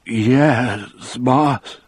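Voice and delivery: scared man obeying; trembling voice. A narrator's reading. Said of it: "his voice was trembling worse now".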